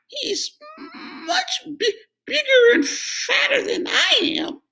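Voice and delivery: very high, trembling, pleading goat; squeaky goat stammering in fear; frightened goat. A narrator's reading. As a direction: beg the troll for mercy, quaking. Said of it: "Middle Billy Goat Gruff wobbled".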